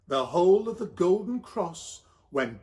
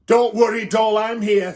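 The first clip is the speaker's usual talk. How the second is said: louder, bustling helper; Doctor Bev's voice; 4 semitones higher, 8 dB louder than usual. Acted. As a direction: barge in to take charge. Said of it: "said Doctor Bev".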